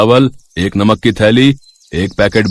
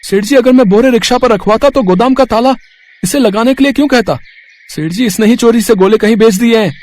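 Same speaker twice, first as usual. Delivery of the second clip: much higher voice, accusing Suman to the boss; high-pitched accusation; 12 semitones higher than usual. Acted. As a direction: shift the blame onto her, pitched well up. Ramesh accuses Suman before boss. (ऊँची आवाज़ में इल्ज़ाम लगाना)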